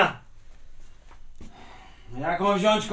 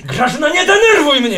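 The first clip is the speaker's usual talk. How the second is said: high, loud exasperated yell; angry shout; 12 semitones higher, 8 dB louder than usual. Acted. Husband losing his temper with wife over her dress, angry shout. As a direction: shout it at the top of your temper. Nie krzycz.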